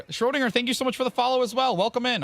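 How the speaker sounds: high, bright streamer greeting; cheerful welcome. Real, not acted. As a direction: welcome them in, pitched high. Streamer greets a new follower.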